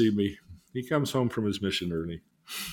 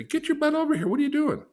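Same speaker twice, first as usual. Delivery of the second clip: put-on high, loud yell at a kid; 5 dB louder than usual. Parent yelling at the kid running to the street, high and loud. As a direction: holler at the kid to get back. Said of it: "You're gonna go yell at him".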